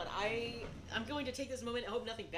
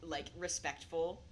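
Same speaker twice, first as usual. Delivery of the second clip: ordinary delivery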